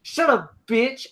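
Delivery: high-pitched mock outburst; imitated rage. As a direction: cut her off in pretend fury. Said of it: "next thing he rages".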